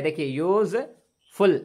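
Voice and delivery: यूज़फुल said slowly as yooz-ful, opening with a y glide; slow model word. Shown as a demonstration. Teacher modelling यूज़फुल slowly for students. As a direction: draw out यूज़फुल with a y sound at the start. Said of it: "यूज़फुल मतलब होता है".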